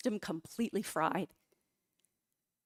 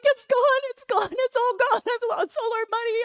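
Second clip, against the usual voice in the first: high, louder, frantic wailing of the loss; panicked wail; 14 semitones higher, 7 dB louder than usual. Retold or imitated. To her husband running upstairs, a high frantic wail. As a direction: cry it out to your husband in panic. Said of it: "And I'm like".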